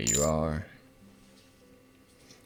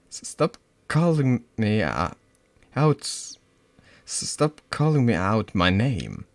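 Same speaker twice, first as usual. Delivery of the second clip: stammering, louder but shaky; trembling; 6 semitones higher, 5 dB louder than usual. Acted. Scared girl pushes back at her tormentor, voice trembling. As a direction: brave but shaking. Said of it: "you can hear your voice tremble".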